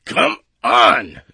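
loud, straining command; grated out. A narrator's reading. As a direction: grate it out while hauling hard. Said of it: "Jones grated".

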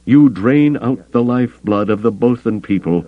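high-pitched, vicious accusation; angry snarl held high. A narrator's reading. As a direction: accuse with a predator's venom, pitch up. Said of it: "his voice about as vicious".

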